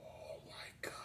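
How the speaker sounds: barely audible, breath-only whisper; awed whisper. Pretend. Host reacts to dream rap battle, awed whisper. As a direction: whisper it in mock awe.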